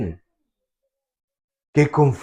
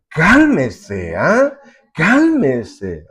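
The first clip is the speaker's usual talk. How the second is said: ordinary talk, nothing marked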